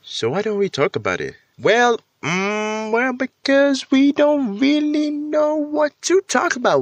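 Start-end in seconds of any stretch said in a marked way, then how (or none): 1.6-6.8 mock voice. Mock voice answering own question.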